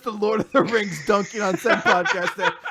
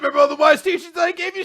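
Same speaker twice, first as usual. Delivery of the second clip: mock boastful shout; 8 semitones higher, 7 dB louder, much faster than usual. Mock hero boasting at funeral.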